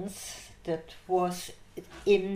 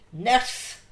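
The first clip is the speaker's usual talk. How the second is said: loud, higher mock call; mock stern call; 5 semitones higher, 8 dB louder than usual. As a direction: imitate a supervisor calling out sharply. Supervisor's startled call, retold.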